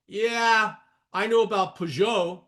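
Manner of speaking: put-on playful French accent on Peugeot. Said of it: "Where does he get that French accent?".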